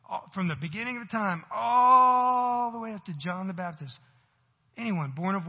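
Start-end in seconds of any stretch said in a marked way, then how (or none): 1.5-4.0 loud, higher, slow shout; preaching shout. Acted. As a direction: swell it up to the rafters. Preaching climax on scripture.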